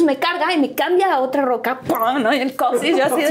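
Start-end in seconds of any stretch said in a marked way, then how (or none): none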